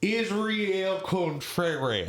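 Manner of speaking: imitating a foreigner's overdone American accent, high and very slow; mock Texan accent. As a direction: stretch it into a botched Texas twang. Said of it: "you were from Texas".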